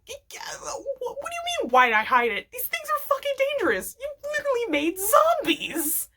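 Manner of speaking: high, stammering, exasperated rant; flustered Stan. Retold or imitated. Stan rants at the kids about zombies, high and flustered. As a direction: sputter it out, caught off guard and defensive.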